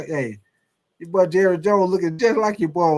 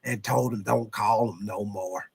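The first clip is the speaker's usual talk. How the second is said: Jerry Jones impression; 5 semitones lower, 4 dB quieter than usual. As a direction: mumble it like an old owner shrugging. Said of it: "a very good Jerry impression".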